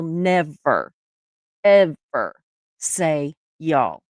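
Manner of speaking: "never", "ever" and "y'all" set apart by pauses; emphatic vow. Retold or imitated. Telling her parents she won't say "y'all", emphatic vow.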